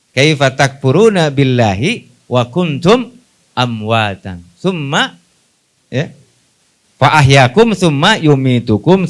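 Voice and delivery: slow, measured chanted Arabic verse; Quran recitation. Acted. Preacher recites the verse to his congregation, slow chant. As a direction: intone the verse slowly like recitation.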